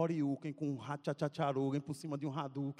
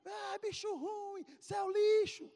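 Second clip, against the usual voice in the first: mock the loser at full voice; gloating bully's shout; taunting yell; slower than usual. Kid taunting after the match.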